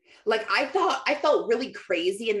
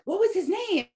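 much higher, urgent question; eager rabbi; 13 semitones higher than usual. Retold or imitated. Rabbi pressing the healed woman for a name, urgent high voice. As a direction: voice the old rabbi leaning in to ask. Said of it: "the guy's like".

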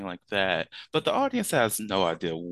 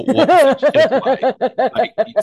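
laughing; 13 dB louder than usual. Real, not acted. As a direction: laugh through the words. Laughing at livestock remark.

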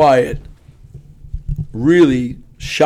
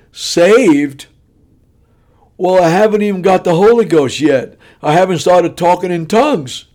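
loud, high imitated indignant wife; explosive outburst; 5 semitones higher, 6 dB louder than usual. Wife to counsellor at the altar, loudly indignant. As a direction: burst out in mock outrage. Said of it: "like she threw a hand grenade".

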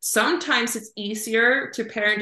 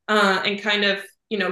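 ordinary delivery

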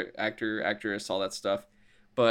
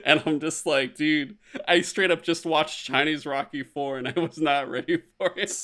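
high, giggling through the words; amused laughing aside; 6 semitones higher than usual. Real, not acted. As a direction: talk while still laughing. Laughing while recounting.

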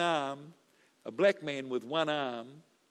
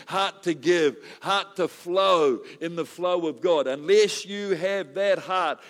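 full-force preaching; 3 semitones higher, 6 dB louder than usual. Acted.

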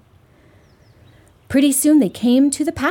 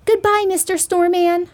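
put-on higher-pitched little girl's goodbye; 7 semitones higher than usual. A narrator's reading. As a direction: say it high, like a little girl. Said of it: "said the little girl".

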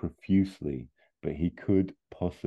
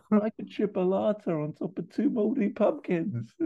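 very high, smothered voice; muffled Austin. Acted. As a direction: squeal it as if trapped under a kilt. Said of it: "you can hear muffled Austin".

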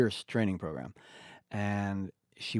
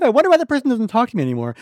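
much higher, louder and fast mock wonder; mock puzzled voice; 13 semitones higher, 12 dB louder, much faster than usual. Imitating a friend puzzled the patient stopped talking. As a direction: ham up the clueless friend. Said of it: "You're like".

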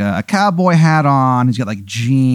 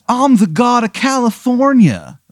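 much higher, proud surfer boast; surfer god voice; 12 semitones higher than usual. Acted. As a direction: announce yourself as a beach deity. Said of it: "He goes".

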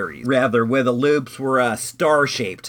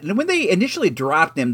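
normal talking voice

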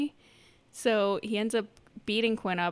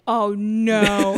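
mock dread; 8 dB louder than usual. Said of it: "I'm like".

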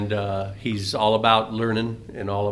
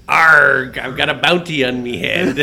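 loud pirate growl on Arg; hammed-up pirate; 2 semitones higher, 6 dB louder than usual. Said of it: "The pirate says".

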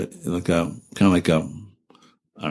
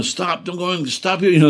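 calling out; 10 semitones higher than usual. Retold or imitated. Gunny yelling at passing Jeep.

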